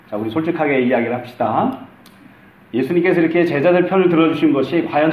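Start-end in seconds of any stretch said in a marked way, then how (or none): none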